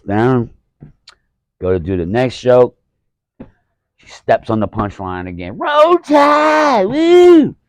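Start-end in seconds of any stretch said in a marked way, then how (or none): 5.6-7.6 very high, loud mock fan shriek; heckler cheer. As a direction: blast the cheer like a heckler ruining the punchline. Heckler steps on punchline again.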